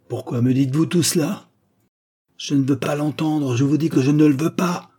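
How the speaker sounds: loud, emphatic refusal, each word driven home; heavily accentuated outburst. A narrator's reading. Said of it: "en affectant d'accentuer grossièrement chacune de ses paroles".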